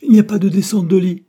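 loud, high exclamation of outrage; high indignant shout; crying out. A narrator's reading. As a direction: cry out at a shocking discovery. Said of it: "en poussant un cri".

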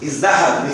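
ازدحم said as izdaḥama, d after z; result with dal. Shown as a demonstration. Teacher giving the form after substitution, joined pronunciation. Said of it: "فقلبت التاء دالا فأصبح ماذا؟".

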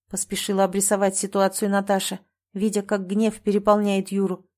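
usual speaking voice

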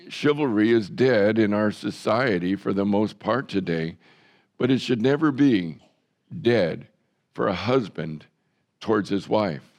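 ordinary delivery

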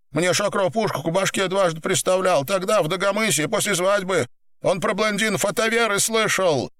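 high, urgent pleading voice; pinned gangster, pleading. Acted. Pinned man explains himself to boss, high urgent pleading. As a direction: plead your case in a high voice.

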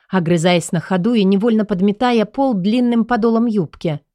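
plain reading voice

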